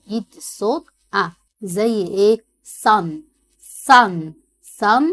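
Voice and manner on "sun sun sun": loud, clear model word; model word for repeating. Shown as a demonstration. Teacher loudly modelling a word for pupils to repeat. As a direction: call out the word for pupils to echo. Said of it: "Listen and repeat".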